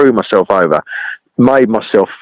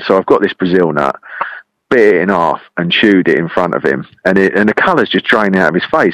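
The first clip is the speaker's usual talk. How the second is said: ordinary talk, nothing marked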